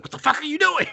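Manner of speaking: high, outraged mock shriek; mock outraged squeal. Imitating the groom reacting to the wife, high mock shriek. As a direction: shriek it in disbelief. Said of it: "he was like".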